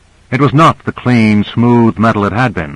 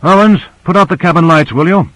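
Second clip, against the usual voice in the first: higher-pitched call across the cabin; called-out order; 8 semitones higher than usual. A narrator's reading. Michaels calls to Owens to put out the lights, calling out. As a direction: call it out as a brisk request. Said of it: "Michaels called out".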